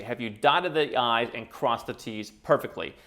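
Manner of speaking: ordinary delivery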